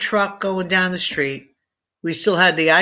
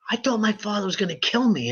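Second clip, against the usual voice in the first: normal talking voice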